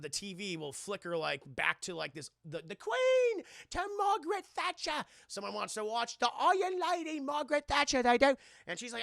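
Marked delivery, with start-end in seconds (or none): 2.8-8.4 high, hammed-up British accent; exaggerated British TV voice; mock British broadcast. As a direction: announce it in a put-on British voice.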